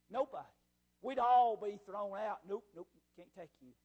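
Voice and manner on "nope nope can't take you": low, soft offhand rejection; dismissive refusal. Acted. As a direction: turn them away under your voice. Acts out turning people away.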